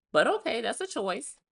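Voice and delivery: ordinary talk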